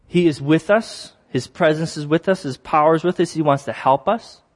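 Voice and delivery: normal talking voice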